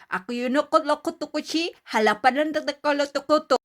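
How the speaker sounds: alien language. Shown as a demonstration. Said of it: "I can speak an alien words".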